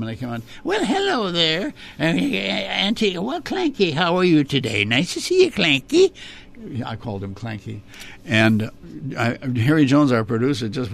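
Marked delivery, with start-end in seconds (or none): 0.6-6.1 Auntie Ketchum. Acted. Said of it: "here comes Auntie Ketchum".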